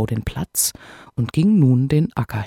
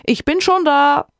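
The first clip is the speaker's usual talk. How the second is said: high, louder triumphant call across the field; 13 semitones higher, 5 dB louder than usual. A narrator's reading. Wife calls to the arriving hare, triumphant. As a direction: shout it from the far end. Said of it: "rief ihm des Igels Frau entgegen".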